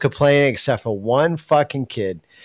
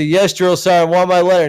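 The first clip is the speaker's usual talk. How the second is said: recruit yelling; 6 semitones higher, 4 dB louder than usual. Retold or imitated. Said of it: "And you say".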